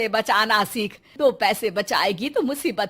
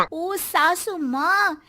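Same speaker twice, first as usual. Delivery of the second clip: slow, sneering drawl; a haughty bride drawling at her mother-in-law; drawn-out mocking call; 4 semitones higher, much slower than usual. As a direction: stretch it out with disdain.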